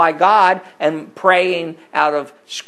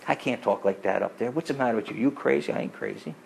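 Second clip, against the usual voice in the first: softer, confiding aside; hushed quote; 4 semitones lower, 10 dB quieter than usual. Retold or imitated. Coworker quietly admitting what he can't say upstairs, hushed. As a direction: murmur it like a secret between friends. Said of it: "he changed his tune".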